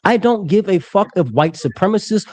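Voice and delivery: normal talking voice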